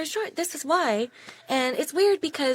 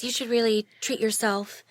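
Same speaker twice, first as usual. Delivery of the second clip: ordinary talk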